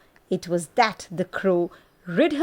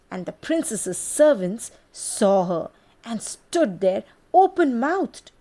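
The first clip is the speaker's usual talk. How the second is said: ordinary delivery, nothing marked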